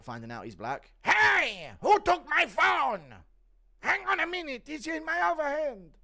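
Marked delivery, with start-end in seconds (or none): 1.0-5.9 very high, shouted caricature voice; Yoel. Acted. Streamer acting a fighter's shocked discovery, loud high impression. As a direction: yell it out, high and stretched.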